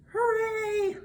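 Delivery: high, squealed hooray; high-pitched party cheer; mock cheer. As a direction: celebrate in a very high voice.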